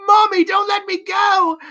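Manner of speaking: high shouted plea; mock pleading child. Esther begging her mother in the ice, high shouted plea. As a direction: scream it like a desperate little girl.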